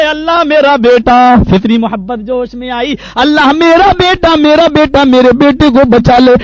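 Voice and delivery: desperate father's loud plea; anguished cry to God. Acted. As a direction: cry out for a drowning son. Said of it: "چیخ پڑے".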